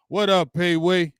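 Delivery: normal talking voice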